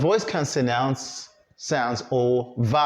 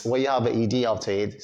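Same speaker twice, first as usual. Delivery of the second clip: ordinary delivery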